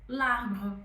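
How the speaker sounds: "l'arbre" as one word; French liaison; linked l'arbre. Shown as a demonstration. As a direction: join the l to arbre. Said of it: "como se juntasse".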